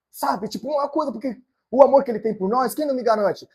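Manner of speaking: ordinary talk, nothing marked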